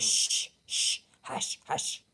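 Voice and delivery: put-on whispered shh, hush, like a little boy hushing grandma; toddler's shushing whisper. Grandson hushing grandma's singing, whispered shushing. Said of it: "He goes".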